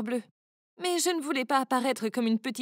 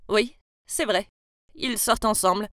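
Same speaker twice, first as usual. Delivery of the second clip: calm; 4 semitones lower than usual. Acted. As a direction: state it gently, without drama. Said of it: "Will a répondu calmement".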